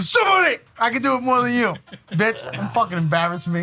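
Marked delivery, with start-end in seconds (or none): 0.1-0.5 high shouted battle cry; samurai voice. Pretend. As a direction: bellow it like a warrior charging.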